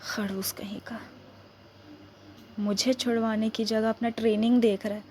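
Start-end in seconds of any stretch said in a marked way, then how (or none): 0.0-1.0 breathy whispered grumble under her breath; whispered insult. Acted. Woman whispering an insult at the officer who left. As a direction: hiss it so he can't hear.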